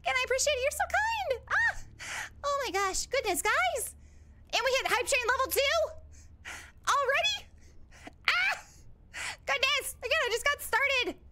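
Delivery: high, squealing, giggly gratitude; overwhelmed excitement. Real, not acted. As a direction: gush in disbelief at sudden generosity. Overwhelmed by bits and hype train.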